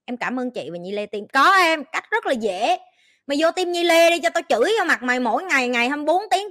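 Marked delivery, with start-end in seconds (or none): 3.3-6.5 higher, louder teasing scold; mock scolding. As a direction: tell the viewer off half-jokingly. Scolding a viewer, mock threat.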